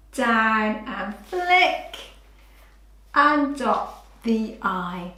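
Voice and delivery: slow, drawn out. Shown as a demonstration. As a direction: narrate the stroke slowly for a child. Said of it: "I'll just demonstrate".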